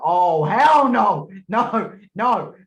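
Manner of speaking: loud, drawn-out mock protest; mock outraged refusal. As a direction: refuse point-blank, hamming it up. Imagined refusal of the role.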